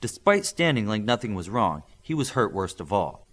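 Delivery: plain reading voice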